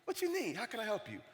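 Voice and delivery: high, hushed brave face; soft, high-pitched deflection; hiding crying. Retold or imitated. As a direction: imitate a teacher covering tears. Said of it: "I caught you crying".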